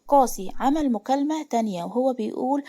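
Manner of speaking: usual speaking voice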